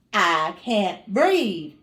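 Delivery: slow, drawn-out I can't breathe; slow pleading. Retold or imitated. To the nurse in labor, slow desperate plea. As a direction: stretch each word like it's hard to get air out.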